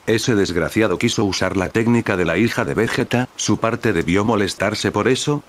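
plain reading voice

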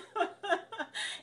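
short falling laugh. Real, not acted. Laughs after join the crowd.

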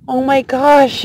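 normal talking voice

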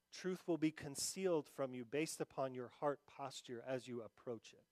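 soft, low, intimate sermon voice; tender preaching. Acted. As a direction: draw them in gently with quiet words. Softer sermon passage.

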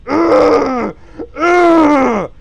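high, loud disgusted ugh; loud groan. Real, not acted. Streamer groaning loudly at his drawing.

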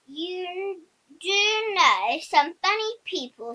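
slow amused tone for the funny people; chuckling Mr Greedy. A narrator's reading. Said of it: "chuckled Mister Greedy".

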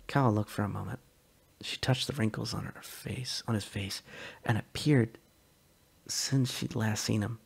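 ordinary delivery

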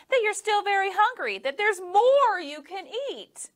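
put-on high, coaxing boy voice; eager little boy coaxing. A narrator's reading. Boy voice in story.

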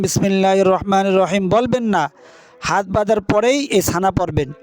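ordinary talk, nothing marked

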